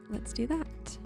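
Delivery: ordinary talk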